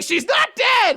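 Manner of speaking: adamant high-pitched mock shout; Natsu. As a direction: insist at a high, hysterical pitch. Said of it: "adamantly being like".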